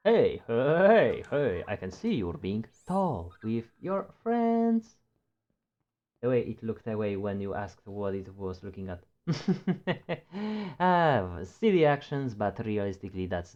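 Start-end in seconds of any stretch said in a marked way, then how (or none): 0.1-5.0 cutesy high voice. Acted. Talking to pet creature. 9.3-10.7 high laugh. Real, not acted.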